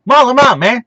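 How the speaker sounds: badly done football coach impression; punchy, high, hammed-up mimicry; John Gruden. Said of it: "that's my awful John Gruden impression".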